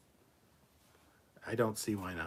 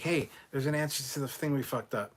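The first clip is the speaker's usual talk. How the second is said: higher, louder imagined team pitch; hammed-up bright-idea announcement; mock eager suggestion; 7 semitones higher, 6 dB louder than usual. Mock quote of the Discovery team.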